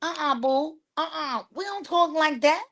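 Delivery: high, lightly voiced kind but firm teacher; correcting a rude student, firm and kind; gentle correction. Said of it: "So I always say".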